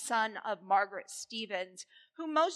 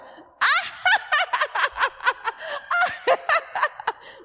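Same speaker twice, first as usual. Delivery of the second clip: high, loud cackling laugh; big forced laugh; 6 dB louder than usual. Shown as a demonstration. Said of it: "Now raise up and laugh".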